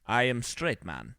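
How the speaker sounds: a hint of a bad movie Russian accent; mock Russian accent. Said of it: "he has the terrible Russian accent".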